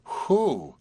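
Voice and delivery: high exclaimed whew; exclamation. A narrator's reading. Marcia reacting to Horace, a high exclamation. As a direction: let the whew jump up in pitch. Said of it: "exclaimed Marcia".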